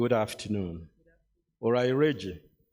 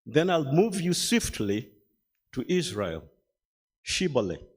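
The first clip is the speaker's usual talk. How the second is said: ordinary talk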